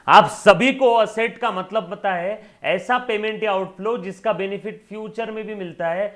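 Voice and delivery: loud, high-pitched teacher's proclamation; emphatic lecturing. Real, not acted. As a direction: belt out the definition to the whole class. Teacher hammering home a definition.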